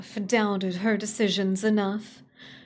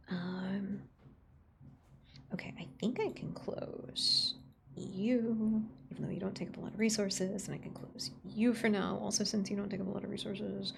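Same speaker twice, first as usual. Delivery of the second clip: soft whisper, fiddling with settings; 9 dB quieter than usual. Real, not acted. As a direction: whisper to yourself while fixing.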